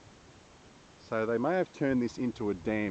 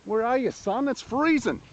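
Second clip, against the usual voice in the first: loud, high call across water; 10 dB louder than usual. Real, not acted. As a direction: shout to someone far away. Calling out across the dam.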